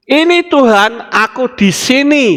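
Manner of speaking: high, bright eager reply; eager answer. Acted. Adam answering God as he should have, eager and high. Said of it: "Harusnya jawabnya begitu".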